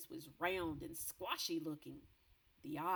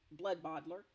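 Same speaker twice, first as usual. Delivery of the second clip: ordinary delivery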